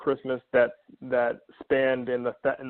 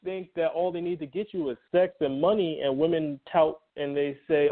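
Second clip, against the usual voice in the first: ordinary delivery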